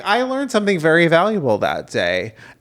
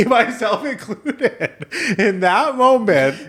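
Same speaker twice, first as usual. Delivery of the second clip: high, laughing through the words; really cracking up while talking; 8 semitones higher, slower than usual. Real, not acted. Recalling everyone laughing at the failed accent, laughing hard.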